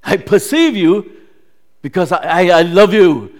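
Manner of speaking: loud, impassioned voice of God; loving proclamation. Acted. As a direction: proclaim it with full force. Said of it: "not the spirit or the tone".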